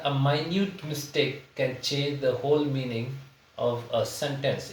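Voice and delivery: slow, word-by-word dictation of the sentence; slow example reading. Shown as a demonstration. Teacher reads the example sentence slowly to students. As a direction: read it out slowly for the class to follow. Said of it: "reads example sentence slowly".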